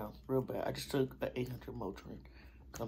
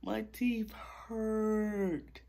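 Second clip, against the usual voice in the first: laughing whine; 8 semitones higher, 9 dB louder than usual. Real, not acted. Laughing and whining to viewers about sore teeth.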